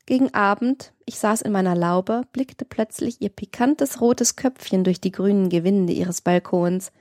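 plain reading voice

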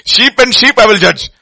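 loud, high declaration; preaching. Acted. Pastor preaching God's judgment to the church, booming. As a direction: thunder it out from the pulpit.

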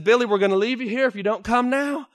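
high, loud last warning; final warning. Acted. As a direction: threaten to leave him. Miners threatening to leave.

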